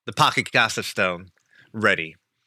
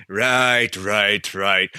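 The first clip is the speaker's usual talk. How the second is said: dry, lower, dismissive; sarcastic guard; 4 semitones lower, much slower than usual. Acted. Guard brushing off the bird's excuse, dry and dismissive.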